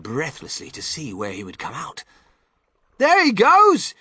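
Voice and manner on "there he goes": high, loud cry of discovery; excited high-pitched yell; shouting. A narrator's reading. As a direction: shout out as you spot him. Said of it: "somebody shouted".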